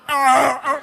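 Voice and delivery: strangled, wordless cry of shock; choked failed scream. Acted. Passenger caught off guard as cab speeds up, choked scream. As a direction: try to scream and fail. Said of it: "I couldn't even scream. I was like".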